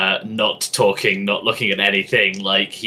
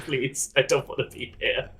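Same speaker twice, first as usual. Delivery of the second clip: higher, softer mock plea; small pleading voice; 5 semitones higher, 7 dB quieter than usual. Player voices Tin wanting out, small and pleading. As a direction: plead meekly under your breath.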